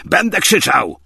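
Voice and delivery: loud, high defiant bellow; bawling. A narrator's reading. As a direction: bawl it out defiantly. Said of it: "gardłował Michej Andrzejewicz".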